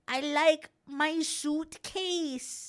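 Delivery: put-on very slow, high small-child voice; little boy voice. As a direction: drag it out like a tiny kid. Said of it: "a seven-year-old going like".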